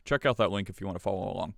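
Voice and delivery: usual speaking voice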